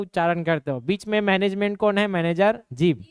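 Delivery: ordinary talk, nothing marked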